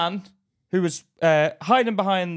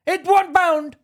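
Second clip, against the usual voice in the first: very high, loud mock yelling; insistent retold shout, pitched way up; 7 dB louder than usual. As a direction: imitate a shopkeeper overriding the customer. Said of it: "to shout me down".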